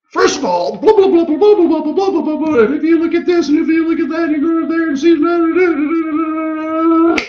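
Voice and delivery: very high, loud torrent of jargon; a frantic breathless info-dump; rapid-fire blah. Pretend. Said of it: "It's gonna be a bumpy, bumpy ride".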